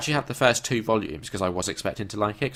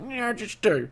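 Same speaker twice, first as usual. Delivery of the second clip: high mocking imitation; 12 semitones higher than usual.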